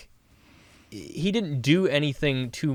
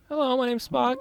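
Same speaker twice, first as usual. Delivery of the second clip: much higher, odd strained voice; jokey Spock impression; 13 semitones higher than usual. Friend asks for Spock, goofy high voice. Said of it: "That was perfect".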